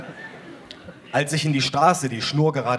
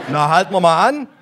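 cop voice; 5 semitones higher, 7 dB louder than usual. Acted. Cop voiced, stand-up reading.

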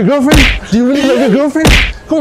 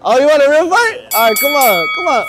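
excited; 7 semitones higher than usual. Real, not acted. Challenging stranger to fight.

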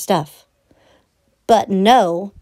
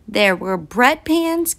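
plain reading voice

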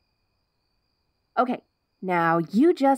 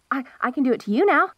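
ordinary talk

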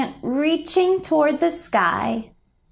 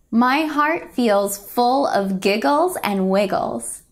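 plain reading voice

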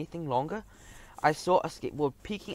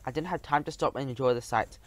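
ordinary delivery

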